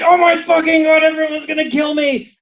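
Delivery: high, loud hammed-up panic; mock paranoid panic. Imitating a tripping drug user, hammed-up panic. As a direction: freak out like everyone is after you. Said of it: "You're just like".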